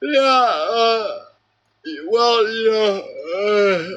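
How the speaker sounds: high, bored, drawn-out mock of a yawning friend. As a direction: imitate a friend who can't be bothered. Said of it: "my friend's just, like, yawning".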